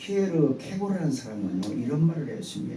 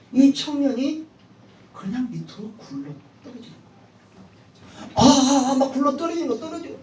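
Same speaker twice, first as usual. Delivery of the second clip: high, urgent preaching of the fall; dramatic storytelling; 11 semitones higher, 5 dB louder than usual. Acted. Pastor dramatizing the young man's fall for the church, high and urgent. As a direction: tell it breathlessly, as if falling yourself.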